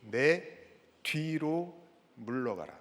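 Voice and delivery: low, measured command, soft at the end; quiet, unhurried correction; corrected reading. Shown as a demonstration. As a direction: set 내 뒤로 apart, slowly and calmly. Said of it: "그 앞에 뭐가 있어요?".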